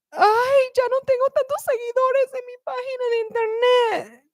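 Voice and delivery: mock whining. Teacher mocks someone crying over lost followers, whiny. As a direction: whimper like an overdramatic influencer.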